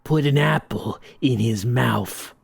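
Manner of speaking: slow, low boss voice; menacing mob boss. Acted. Mob boss ordering a hit, low and menacing.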